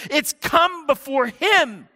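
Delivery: loud, high preacher's cry; emphatic declaration. Acted. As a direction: ring it out at full voice. Acted quote in the sermon, full force.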